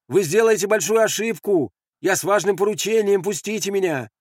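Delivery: high, loud plea; urgent calling out. Acted. Messenger warning the sentries of their mistake, calling out. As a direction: call up to them insistently. Pleading loudly with armed sentries.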